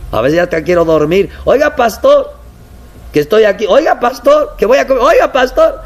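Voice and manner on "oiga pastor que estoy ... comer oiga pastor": high, insistent calls of oiga pastor; pestering church member. Retold or imitated. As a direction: keep nagging the pastor, pitched way up. (feligrés insistente, voz aguda)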